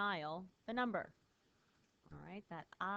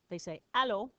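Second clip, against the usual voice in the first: allô said loud and bright, rising; stress on allô; 3 semitones higher, 10 dB louder than usual. Shown as a demonstration. Teacher showing how French people answer the phone, with allô stressed. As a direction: hit the word allô hard. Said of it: "when French people say hello on the phone, they don't say bonjour".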